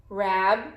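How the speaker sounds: "Rabbit" as rab ... bit, long pause between; split into syllables. Shown as a demonstration. Teacher has pupils count syllables, "Rabbit" pulled apart as rab, bit. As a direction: break "Rabbit" in two, first syllable held. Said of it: "we split this word to show".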